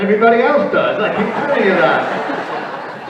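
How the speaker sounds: loud, laughing tease; laughing joke. Real, not acted. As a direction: deny it with a laugh, playfully. Joking denial about his accent.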